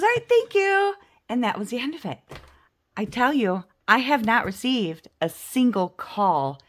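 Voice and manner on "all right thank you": much higher, louder and bright; sweet phone goodbye; cheerful caller. Retold or imitated. Julie ending call.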